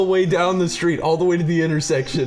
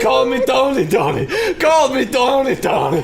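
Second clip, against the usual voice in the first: high, louder mock shout of the name; retold yelling; 9 semitones higher, 5 dB louder than usual. Teller imitates the friend yelling during the prank. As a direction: bellow the chant like the prankster did. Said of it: "just yelling".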